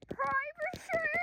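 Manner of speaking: high, loud, drawn-out shout; demanding yell. Acted. Demanding privacy from camera girl.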